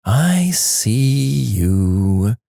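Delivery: very slow, creepy sing-song; eerie, teasing singsong; soft sing-song. A narrator's reading. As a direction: draw each word out in a sing-song. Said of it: "in a soft sing-song voice".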